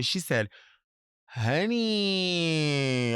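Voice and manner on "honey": higher, long falling "Honey"; elongated, showy "Honey" sliding down; drawn out. Acted. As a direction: stretch "Honey" for everyone to hear. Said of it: "she just laid it out there".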